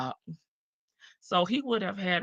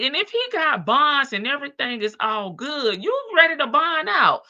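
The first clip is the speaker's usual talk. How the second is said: ordinary delivery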